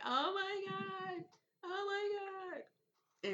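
high, slow, mock sobbing wail; imitating frantic crying. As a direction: stretch it out like a sobbing, terrified girl. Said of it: "frantically crying".

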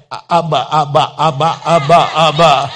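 lady stammering Abba in shock; stuck on Abba, lightly voiced; flustered stammer. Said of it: "It was hilarious".

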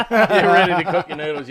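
loud laughter through the line; cracking up while teasing; laughing out the tease at full volume. Real, not acted. Teasing friend, laughing hard.

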